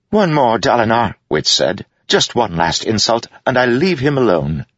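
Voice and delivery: high, eager jester plea; pleading jester. Acted. Wit pleads playfully.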